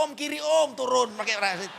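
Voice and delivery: loud, high holler, hammed-up; mock calling out. Passenger calling to get off the plane, mock holler. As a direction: holler as if stopping a bus. Mocks hailing a stop like on bus.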